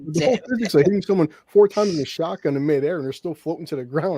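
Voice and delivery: normal talking voice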